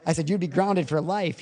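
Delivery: high, animated retold dad scolding; mock scolding. Dad telling his son he'd be in trouble, mock scolding.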